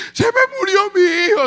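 high, crying wail of a mother; mother shrieking that her son died; screaming mother. Retold or imitated. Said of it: "sale la hermana gritando".

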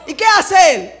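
ordinary talk, nothing marked

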